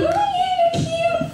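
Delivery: very high, singsong kitten coo; mock baby talk. Child playing with kittens, squeaky singsong cooing.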